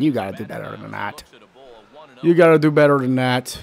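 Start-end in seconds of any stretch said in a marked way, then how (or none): 0.0-1.2 softer, low grumble at the pitcher; quiet disappointed grumble; muttered scolding. Real, not acted. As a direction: mutter it under your breath. Scolding his own pitcher.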